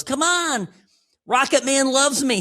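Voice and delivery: high, loud mock Trump bragging; Trump impression. Host mimics Trump boasting about Rocket Man, high and loud. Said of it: "He goes".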